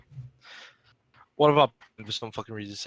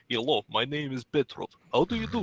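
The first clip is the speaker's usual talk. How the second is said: mock Eastern European accent; 2 semitones higher than usual. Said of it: "That's not Polish".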